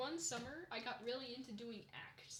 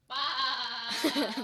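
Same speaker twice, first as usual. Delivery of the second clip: loud copied cartoon bleat; imitated bleating cackle; SpongeBob's laugh; 13 dB louder than usual. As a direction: try a long sheep-like laugh. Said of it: "I've gotta try that".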